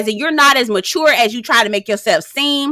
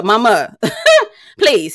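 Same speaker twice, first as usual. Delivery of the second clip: usual speaking voice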